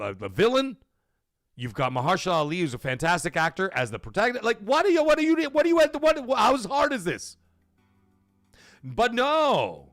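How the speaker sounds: ordinary delivery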